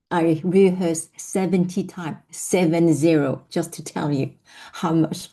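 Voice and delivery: ordinary talk, nothing marked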